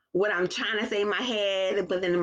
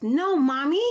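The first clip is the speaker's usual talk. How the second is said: put-on child voice; 7 semitones higher, 4 dB louder, slower than usual. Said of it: "She says".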